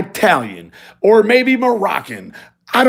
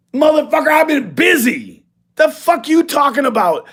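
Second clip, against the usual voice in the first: incredulous retort; 9 semitones higher than usual. Retold or imitated. Brushing off the ring challenge, high and incredulous. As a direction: scoff at the idea, pitched up. Retold phone quarrel.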